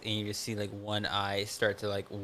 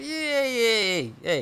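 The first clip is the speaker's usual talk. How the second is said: much higher, slow, loud hammed-up Italian accent; 12 semitones higher, 6 dB louder, slower than usual. Doing the husband as an Italian in an Italian accent. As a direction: drag it out like a cartoon Italian tough guy. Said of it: "He just got real Italian".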